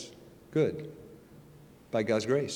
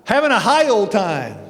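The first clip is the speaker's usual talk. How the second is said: much higher, louder, boastful and hammed-up; young [name] in the bar; 9 semitones higher, 7 dB louder, slower than usual. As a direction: brag about the good time like a cocky young drinker. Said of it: "What would I say?".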